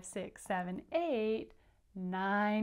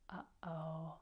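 hushed uh-oh; 4 semitones lower, 9 dB quieter than usual. A narrator's reading. Story suspense for children.

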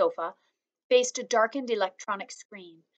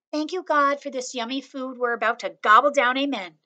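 put-on childlike grace; 4 semitones higher, 6 dB louder than usual. A narrator's reading. Little girl says grace.

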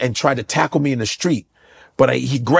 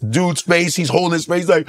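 ordinary talk

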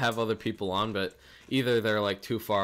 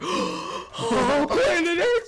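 very high, louder hysterical wail; mock panic; 5 dB louder, slower than usual. Conspiracy fan panicking about the invasion, high hysterical wail. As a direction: shriek it like a panicked believer. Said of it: "people who are like".

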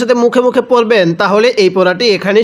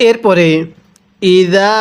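normal talking voice

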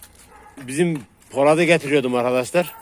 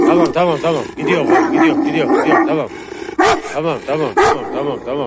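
louder, higher, rapid repetitions; urgent reassurance; 4 semitones higher, 4 dB louder than usual. Real, not acted. Owner settling agitated dogs, urgent reassurance. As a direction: insist over the noise that it's fine.

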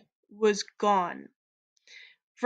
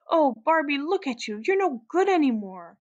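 high, distressed lament; acted tearful reproach, pitched up; sobbing; 5 semitones higher, 4 dB louder than usual. A narrator's reading. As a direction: wail it as if near tears. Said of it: "Veronica sobbed".

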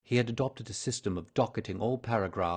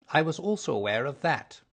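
usual speaking voice

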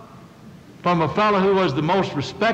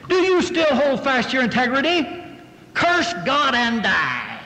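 scornful wife; 7 semitones higher than usual. Acted. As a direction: play the devilish wife, pitched up and accusing. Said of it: "I don't think she said it in that tone".

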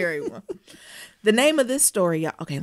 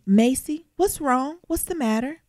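a touch of a worried mother, pitched up; mother calling; 7 semitones higher, slower than usual. Acted. Mother asking her daughter what's wrong, lightly voiced. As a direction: call up the stairs to your daughter, lightly. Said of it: "said to me".

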